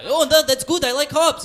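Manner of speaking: very high, loud mock Russian; over-eager, hammed-up Russian accent; Russian terrorist accent. Terrorist trying to convince bartender, in mock Russian accent. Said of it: "this is a Russian terrorist".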